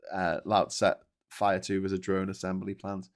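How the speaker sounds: ordinary talk, nothing marked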